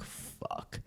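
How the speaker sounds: breathless whispered curse; awed whisper. Retold or imitated. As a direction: whisper it in awe. Awed at the jungle.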